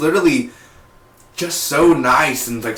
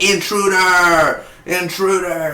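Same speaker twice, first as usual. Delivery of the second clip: higher, slow drawn-out warning cry; mock alarm call; 6 semitones higher, slower than usual. Mimicking Sarah raising the alarm, mock call. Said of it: "and she's like".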